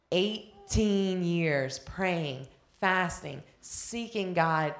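drawn out slowly, each word weighed; slow it down and let it land; heavy slow stress on eighteen; slow emphasis. Real, not acted. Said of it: "slowed for emphasis".

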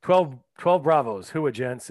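loud, slow military bark; loud gung-ho soldier. Acted. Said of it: "What the heck is that, John?".

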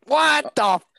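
loud, high-pitched cry of disbelief; outraged protest. Real, not acted. Player objects to a rival's extra guess, real outraged shout.

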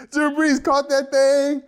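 ordinary talk, nothing marked

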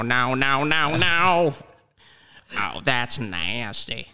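ordinary talk

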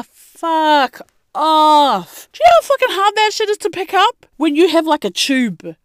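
fast, high exasperated rant. Real, not acted. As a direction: rattle it off in disbelief. Exasperated rant about bending.

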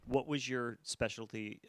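ordinary delivery

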